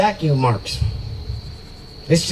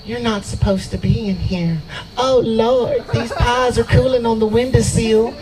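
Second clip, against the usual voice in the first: Southern belle; 6 semitones higher than usual. Retold or imitated. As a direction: coo like a Southern belle, higher pitched. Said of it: "when I had a Southern Belle".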